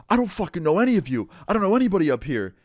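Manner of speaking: fast, high, loud imitated outburst; losing it. Homeless man denying he knows anyone, imitated frantic outburst. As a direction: rattle it off in an acted panicky rage. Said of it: "loses his shit".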